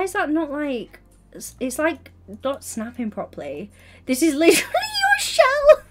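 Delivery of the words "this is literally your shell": much higher, louder cry through laughter; laughing shriek. Real, not acted. Streamer shrieks at teammate Bellamy over a broken build, laughing. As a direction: shriek it while cracking up.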